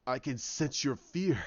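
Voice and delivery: villain taunts a frightened Rey, slow and low; smug villain. Retold or imitated. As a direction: savour each word like a predator. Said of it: "he's sitting there and he's smiling".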